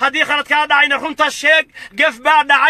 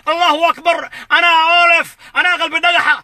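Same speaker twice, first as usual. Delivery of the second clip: ordinary delivery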